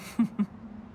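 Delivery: breathy little laugh; a short amused breath of laughter; soft laugh. Acted. Pleased after praising Yuheng.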